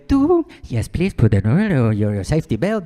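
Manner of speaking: loud, garbled pilot announcement over the intercom; mock cabin announcement. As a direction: bark it like a crackly cabin speaker.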